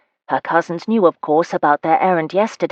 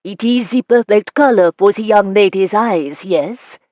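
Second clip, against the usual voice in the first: hissing put-on French accent, louder and higher; modiste's hissed fake French; faux French accent; 4 semitones higher, 7 dB louder than usual. A narrator's reading. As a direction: sell the silk with a fake French lilt. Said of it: "in her faux French accent".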